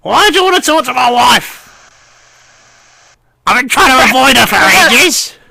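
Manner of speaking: put-on high, reedy old gnome voice, henpecked; old gnome mishearing superior as wife, high and fretful; old gnome lord. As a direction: whine it up high like a fussy old lord avoiding his wife.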